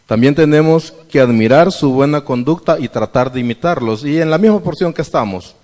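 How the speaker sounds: normal talking voice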